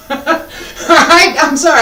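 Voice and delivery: laughing apology after a stern warning. Real, not acted. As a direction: chuckle through it.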